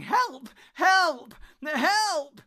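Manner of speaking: shrill, very loud screams; crying for help. A narrator's reading. Hobbit running along the forest path, shrilly screaming for help. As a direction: scream desperately for rescue.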